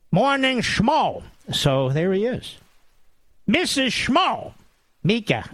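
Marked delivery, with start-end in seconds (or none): 0.1-1.2 high, drawn-out chant of the show's name; mock chorus answer. North Korean generals name their favorite show, high drawn-out chant. 3.5-4.5 high, drawn-out mock introduction; mocking announcement. Host introduces the co-host by nickname, high mocking drawl.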